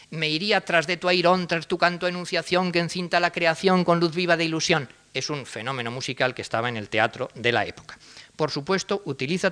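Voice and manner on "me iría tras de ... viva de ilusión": higher, sing-song parody verse; lilting parody of modernist rhyme; mock verse recital. Shown as a demonstration. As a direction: recite with mock-poetic lilt. Reads the rhyme-swapped verse.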